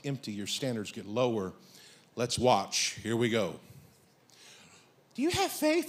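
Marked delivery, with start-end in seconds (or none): 5.1-5.9 higher, soft imitation of a woman; lightly put-on girlish voice; woman on the dating show. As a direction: voice her softly, pitched up. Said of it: "This is her talking".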